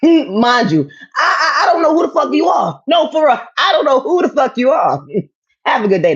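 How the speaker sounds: high, loud, laughing outrage; laughing yell. Real, not acted. Telling the unknown troll off, loud and laughing.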